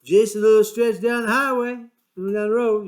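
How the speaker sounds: mocking the driver. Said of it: "Kicks us out and says".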